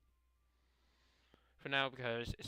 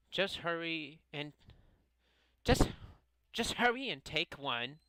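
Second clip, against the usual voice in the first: put-on pitched-up, insistent anime-girl voice; Osana; 4 semitones higher, 3 dB louder than usual. Club girl urging friends to take a cupcake, insistent character voice. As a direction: push them along in a higher character voice. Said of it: "Osana's voice".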